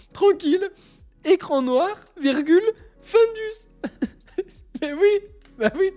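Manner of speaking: shrieking laugh on Findus; laughing high. Real, not acted. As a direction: squeal with tears of laughter. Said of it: "des barres de rire". (éclat de rire aigu)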